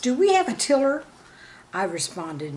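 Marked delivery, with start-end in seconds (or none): 0.0-1.0 lightly voiced eager kid, quick and higher; eager child. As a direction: blurt it out like a keen gardener kid. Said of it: "A dedicated gardener asked".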